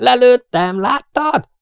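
high, loud triumphant yell; elated yell rising into an eager question; excited shout. A narrator's reading. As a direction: shout for a witness, voice soaring. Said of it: "ordította Luke".

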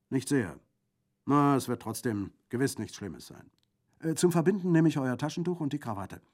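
loud, lively bandit voice; brisk bandit, cheerful. Acted. Bandit examines the wounded arm, loud and cheerful. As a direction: check the wound with hearty confidence.

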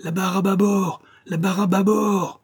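high, drawn cry to a distant ship; calling out. A narrator's reading. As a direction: call the order across the water, pitched high. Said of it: "faisant signe avec sa casquette en criant". (crier un ordre au loin)

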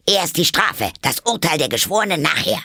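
high, loud commanding queen; bossy queen. A narrator's reading. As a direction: lay down the law, loud and high. Queen insists on punishment first.

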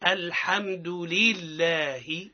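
very slow لله with a clear kasra and a long lam; drawn-out model. Shown as a demonstration. Teacher gives the class لله to repeat. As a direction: stretch لله out for them to repeat. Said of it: "نعاودوا مع بعضنا".

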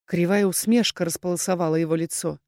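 ordinary delivery, nothing marked

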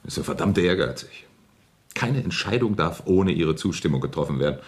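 ordinary talk, nothing marked

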